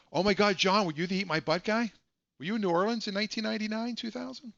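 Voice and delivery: sudden mock realization; mock accusing. Real, not acted. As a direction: playfully accuse John of being the caller. Jokingly accusing a viewer.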